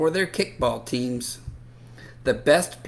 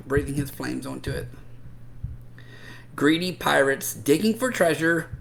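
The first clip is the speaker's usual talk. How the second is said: ordinary delivery, nothing marked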